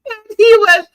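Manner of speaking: loud, high, helpless laughter in the voice; real laughing. Real, not acted. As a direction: struggle to speak while cracking up. Cracking up while retelling friend's scolding.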